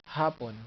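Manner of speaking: hapon with HA stressed; "hapon", strong first syllable, light second. Shown as a demonstration. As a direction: hit the start, HA-pon. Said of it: "and you accent".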